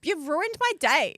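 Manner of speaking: sulky. Retold or imitated. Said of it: "I'm like".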